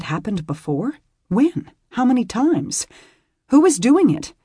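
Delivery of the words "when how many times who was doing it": high, rising alarmed questions; panicked questions. Acted. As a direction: thoughts racing in fear. Beth's panicked thoughts.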